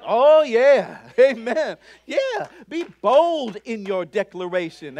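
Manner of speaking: ordinary delivery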